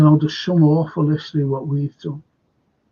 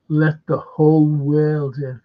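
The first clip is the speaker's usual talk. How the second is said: ordinary talk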